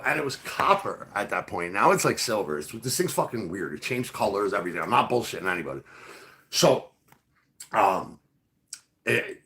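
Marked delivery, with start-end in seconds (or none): none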